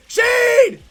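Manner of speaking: loud, high outburst of disbelief; exasperated scream. Real, not acted. Player whose teammate hasn't moved, exasperated scream. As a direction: yell at the teammate, coming down gradually.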